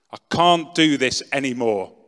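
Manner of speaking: loud despairing cry; shouting to the ceiling. Retold or imitated. Alone on the floor, shouting at the ceiling in despair. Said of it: "So I shouted out at the ceiling".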